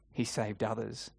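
low, soft mocking quote; a touch of scorn, kept low and quiet; understated sneer. A narrator's reading. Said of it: "they sneer".

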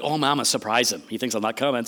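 low aside to oneself; playful scheming. Pretend. Preacher imagining himself as Jesus planning a surprise, playfully scheming.

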